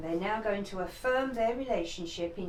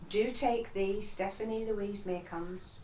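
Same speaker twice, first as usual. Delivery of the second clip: saying wedding vows; 18 dB louder than usual. Real, not acted. Bride repeats vows after registrar.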